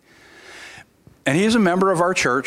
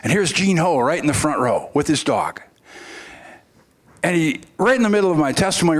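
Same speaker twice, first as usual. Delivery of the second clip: ordinary delivery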